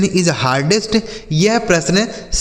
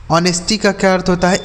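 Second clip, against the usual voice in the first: normal talking voice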